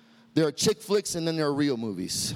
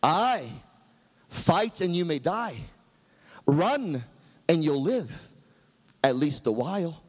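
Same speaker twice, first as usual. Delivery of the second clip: a hint of Braveheart rallying his men, rising to a high shout; Wallace battle speech; 7 semitones higher, slower than usual. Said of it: "Mel Gibson ... addressing his band of brothers".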